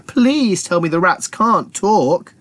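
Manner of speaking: high, slow plea; pleading Militia. Acted. Militia begging the others, slow and high. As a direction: beg it slowly. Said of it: "said Militia".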